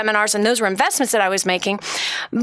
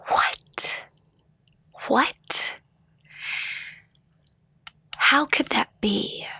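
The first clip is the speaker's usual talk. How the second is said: breathless, hushed disbelief; a whispered what, twice, then a slow question; stunned whisper; 3 semitones higher, 4 dB quieter, much slower than usual. Real, not acted. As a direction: let the shock drain the voice to a whisper. Shock at her being eighty-nine.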